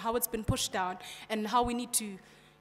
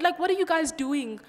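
ordinary talk

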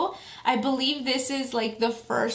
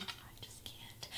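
whispering; 25 dB quieter, slower than usual. Real, not acted. Overwhelmed by cuteness.

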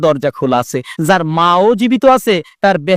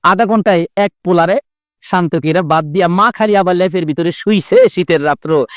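normal talking voice

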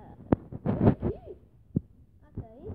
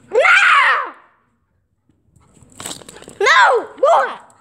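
frustrated shout; 9 semitones higher, 5 dB louder, much slower than usual. Real, not acted. Frustrated yelling in game.

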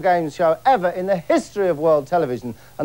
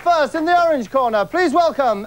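boxing-ring announcer; 10 semitones higher than usual. Acted. Host announcing contestants to the studio crowd, ringside announcer style. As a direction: stretch each name like a fight announcer.

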